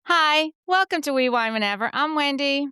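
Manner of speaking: bright, high, loud host welcome; upbeat greeting. Real, not acted. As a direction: greet the listeners with a lift. Hosts greeting podcast listeners.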